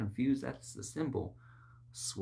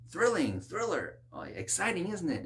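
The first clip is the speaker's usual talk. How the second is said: mock thrilled gushing; 7 semitones higher than usual. Mocking thrill-seeking viewer.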